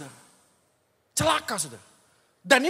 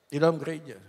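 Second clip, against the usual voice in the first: quiet echo; 9 semitones lower, 5 dB quieter than usual. Shown as a demonstration. Preacher echoing his point to the congregation, hushed. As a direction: repeat "Di dalam gereja" softly and lower, falling on "dalam".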